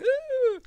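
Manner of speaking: sudden high shriek; scream. Retold or imitated. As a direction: shriek in terror. Susan freaking out on holy ground.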